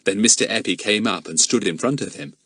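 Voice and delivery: ordinary delivery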